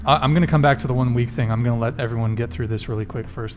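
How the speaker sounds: usual speaking voice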